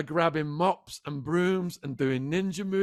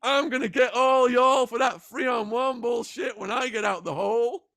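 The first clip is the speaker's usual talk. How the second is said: higher, louder shouted threat; angry threat; 7 semitones higher, 4 dB louder than usual. Retold or imitated. Unscratched inmate yelling threats at the guards, angry shout. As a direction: yell it at the guards, furious and cocky. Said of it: "And he was like".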